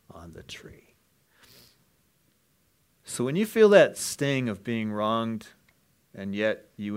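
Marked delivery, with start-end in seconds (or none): 0.1-0.9 breathy whisper. Acted. Preacher whispering on the tree to the congregation. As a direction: drop to a hushed whisper.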